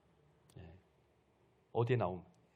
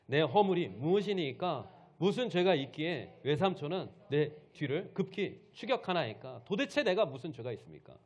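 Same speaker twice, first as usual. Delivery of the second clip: loud, raised-pitch indignant demand; angry rebuke; 4 semitones higher, 6 dB louder than usual. Acted. Jacob challenges his pursuing uncle Laban, angry rebuke. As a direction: read it as a heated protest. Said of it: "야곱이 노하여 라반을 책망할세".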